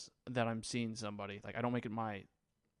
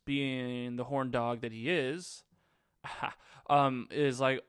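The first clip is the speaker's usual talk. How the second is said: normal talking voice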